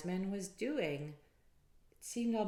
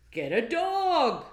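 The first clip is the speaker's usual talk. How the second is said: slow, higher and louder advice; wise men's call; 10 semitones higher, 16 dB louder, slower than usual. Acted. Wise men telling Peter, slow and loud. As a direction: announce it like a sage.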